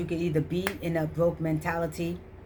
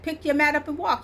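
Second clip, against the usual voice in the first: commanding Lord; 9 semitones higher than usual. Retold or imitated. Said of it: "He's like".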